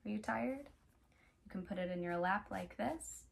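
ordinary delivery

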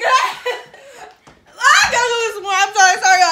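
loud wailing half laugh; yelling through tears of pain; crying shout. Real, not acted.

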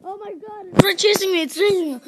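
panicky, rising voice; scared horror game streamer. Real, not acted. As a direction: yelp it out as it chases. Zombie chasing in game.

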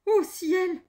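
high, loud cry of alarm; startled exclamation. A narrator's reading. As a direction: gasp out in acted fright. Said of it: "s'exclama-t-il".

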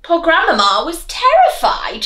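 usual speaking voice

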